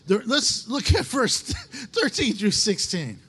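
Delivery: laughing through the words. Real, not acted. Preacher steering back to the verse, helpless laughing. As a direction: try to carry on while cracking up. Cracking up, resumes the reading.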